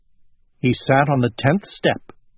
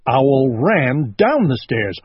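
ordinary delivery

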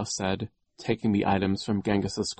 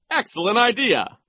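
high, loud approval; pompous delight; gleeful vice principal; 7 dB louder than usual. Acted. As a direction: applaud it with relish. Voices Nero approving.

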